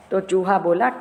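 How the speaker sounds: ordinary delivery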